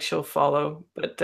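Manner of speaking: usual speaking voice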